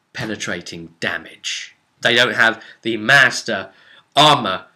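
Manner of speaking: ordinary talk, nothing marked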